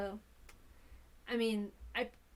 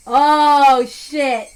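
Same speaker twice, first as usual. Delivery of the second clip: sudden loud outburst; girl frightened by a footstep behind her; startled curse; 13 dB louder than usual. Acted. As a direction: jump at the sound.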